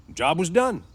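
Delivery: high, loud, ready reply; prompt answer. A narrator's reading. New hand answering the boss, promptly. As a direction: answer at once, emphatically. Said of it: "said George promptly".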